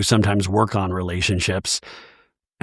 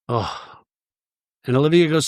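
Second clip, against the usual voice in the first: ordinary talk, nothing marked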